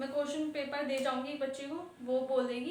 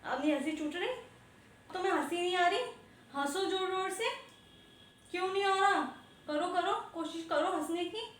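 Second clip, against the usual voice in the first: higher, mocking dare to laugh; sarcastic taunt; 6 semitones higher than usual. Real, not acted. Teacher mocking students who laughed earlier, sarcastic taunt. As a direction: needle them, daring them to laugh now.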